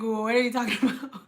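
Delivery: retold baffled question breaking into laughter; confused laughing. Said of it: "I'll laugh and then make people mad".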